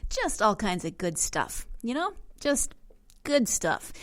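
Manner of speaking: dry sarcasm, pitch lifting on the repeat; sarcastic. Real, not acted. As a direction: say it with obvious sarcasm. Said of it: "that's sarcasm, just in case you didn't hear it in my voice".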